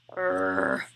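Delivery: loud exasperated urgh; annoyed growl of frustration; frustrated groan. Real, not acted. Frustrated with editing.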